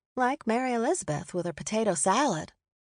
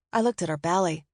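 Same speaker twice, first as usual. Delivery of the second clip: plain reading voice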